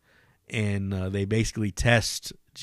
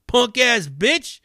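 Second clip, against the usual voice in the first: much higher, louder jeering repeat; taunting cameraman; 12 semitones higher, 4 dB louder than usual. Retold or imitated. Cameraman lighting up the homeless man, high jeering taunt. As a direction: push the insult harder. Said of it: "lighting him up verbally".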